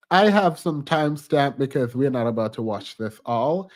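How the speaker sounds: ordinary delivery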